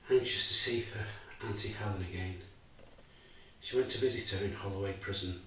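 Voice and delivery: quiet, measured, heavy with loss; a father recalling betrayal and his wife's death; grieving father's monologue. Acted. A father telling the audience of loss, subdued and grieving. Actor performs Bob's final monologue.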